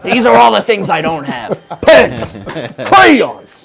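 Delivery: loud, barking tyrant boss; angry boss. Retold or imitated. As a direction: bark it like a furious boss. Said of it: "You sound like an angry boss".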